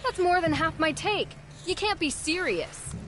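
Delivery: high-pitched, disbelieving objection; distressed protest. Acted. Vendor protesting an extortion demand.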